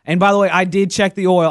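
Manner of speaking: ordinary talk, nothing marked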